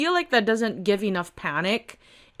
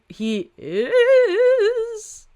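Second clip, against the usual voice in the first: jumps high on he is; surprised spotting; 6 dB louder than usual. Acted. Rescuer finding the trapped person, high and surprised. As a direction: catch sight of him, surprised.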